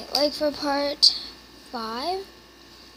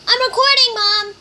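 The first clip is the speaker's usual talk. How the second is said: loud high protest to mom; telling mom off from another room; calling out; 9 semitones higher, 10 dB louder than usual. Real, not acted. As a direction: shout it so mom hears.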